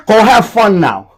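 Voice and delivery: high, booming order; loud send-off. Acted. Telling someone they did their part, loud send-off. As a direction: shout it like dismissing someone for good.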